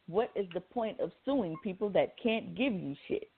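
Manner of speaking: normal talking voice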